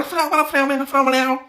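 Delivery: put-on very high gibberish parody of French-accented English; exaggerated French accent, pitched far up; mock French accent. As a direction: mimic her French accent in a squeaky, high voice. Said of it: "Garde ton accent français pour parler en anglais".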